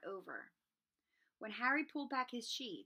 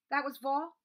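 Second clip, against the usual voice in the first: croaking; 6 semitones higher, 9 dB louder than usual. Acted. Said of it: "Harry croaked".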